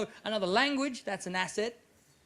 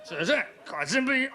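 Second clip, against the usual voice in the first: ordinary talk, nothing marked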